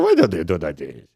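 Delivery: put-on distinctive Romanian comedian's accent and voice; heavily Romanian diction, mimicked; Radu. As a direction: imitate a Romanian comic's odd melody. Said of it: "he always talked like this".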